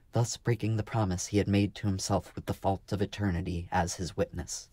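ordinary delivery

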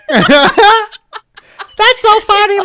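laughing in high pitch. Pretend. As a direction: laugh through the high put-on voice, slowly. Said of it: "He even laughs in the high pitch".

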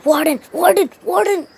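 hammed-up insistence on his title; insistent calling. Grand Warden insisting on his name, mock outraged. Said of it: "literally three times".

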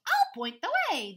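high, loud squawk; squawking rooster. Acted. Rooster volunteers to lead, loud high squawk. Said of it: "Rooster squawked".

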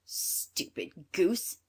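put-on slow, drawn-out scornful old witch; old woman. A narrator's reading. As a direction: insult the child slowly, as the witch. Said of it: "said the old woman".

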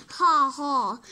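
loud pirate ha ha; loud, gloating pirate laugh; mocking laugh. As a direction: belt out a taunting laugh. Pirate mocks the dodo.

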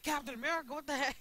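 high, loud re-enacted outburst; high-pitched shout of mock outrage; retold outraged surprise. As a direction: replay the shock at full voice. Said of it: "I'm like".

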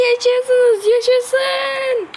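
high, loud joyful cheer; excited cheer. Real, not acted. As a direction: celebrate that friends survived. Arkadaşları sağ görünce sevinç. (sevinçle bağırarak)

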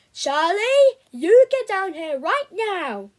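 angry mum bellowing for her son. Acted. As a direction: roar it up the stairs. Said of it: "I could hear her bellowing".

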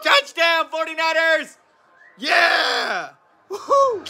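high, excited shouts of joy; cheering at a game. Real, not acted. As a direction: yell like your team just scored. Fan watching 49ers pick six.

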